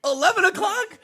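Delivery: high laughing disbelief; incredulous. Real, not acted. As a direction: squeal it in amused disbelief. Incredulous laughing at late wake-up.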